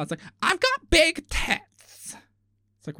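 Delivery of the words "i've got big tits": slow, drawn-out, higher mock boast; mock bimbo boast. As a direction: stretch it out like a dim show contestant bragging.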